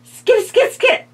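high, loud frightened cry; frightened shout. A narrator's reading. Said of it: "shouted the little old lady, frightened though she was".

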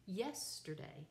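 Yesterday with YES hit hardest; stress on first syllable. Shown as a demonstration. Teacher demonstrating a dactylic foot, Yesterday stressed on the first syllable. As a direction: punch the first syllable of Yesterday and let the rest fall. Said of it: "with the accent on the first one".

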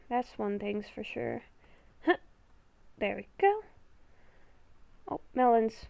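ordinary talk

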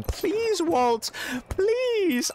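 high-pitched pleading impression; Skyler. Retelling a favorite Breaking Bad scene, high pleading impression. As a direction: draw out the pleas in a high mimicking voice. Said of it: "where it's Skyler going".

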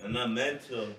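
speaking off-mic, far and quiet; faint voice away from the microphone; turned away from mic. Real, not acted. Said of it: "Into the microphone, Big Che".

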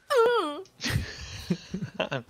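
high whining creature cry; high, drawn-out mock whimper; imitated creature whine. Shown as a demonstration. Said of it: "That's what they sound like".

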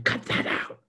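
whispered, hissed "Cut that out."; hushed scold. Retold or imitated. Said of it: "embarrassed if you start the crying".

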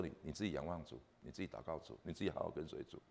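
soft, hushed retelling of a dying wife; dying wife's words. Retold or imitated. Husband recalls his dying wife, softly hushed. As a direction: gently pass on her last request. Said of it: "那我太太说".

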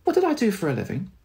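lightly voiced puzzled old man; a hint of a lost father asking his wife; confused father. Confused dad asking his wife about his job, lightly voiced. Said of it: "he turned to my mum and it was like".

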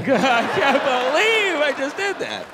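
ordinary delivery

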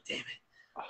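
breathy whispered curse under the breath. Retold or imitated. Coach walking away after the runners missed by seconds, whispering. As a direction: let it out as a whisper.

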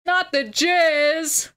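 mock dismay.

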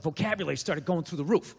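normal talking voice

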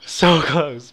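shouting at a near miss; real exclaiming. Real, not acted. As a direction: yell it in disbelief.

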